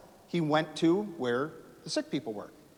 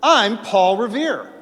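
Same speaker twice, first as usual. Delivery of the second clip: loud, slow, higher declaration; proud announcement; 7 semitones higher, 11 dB louder, slower than usual. Retold or imitated. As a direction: say your name like a banner. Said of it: "What does Paul Revere do?".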